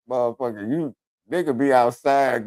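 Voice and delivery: usual speaking voice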